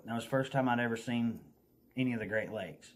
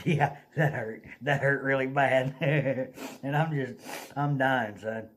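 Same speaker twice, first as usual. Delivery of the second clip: re-enacted pained laughter, louder; laughing through pain; 2 semitones higher, 5 dB louder than usual. Telling the driver it hurt, imitated laughing through pain. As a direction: laugh while admitting how much it hurt. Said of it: "started laughing".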